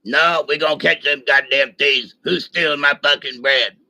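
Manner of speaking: gruff sergeant voice; imitating Castleberry. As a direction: growl it like the angry Sarge.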